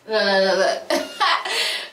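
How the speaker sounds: short happy laugh; relieved laugh. Real, not acted. Fire catches, laughs.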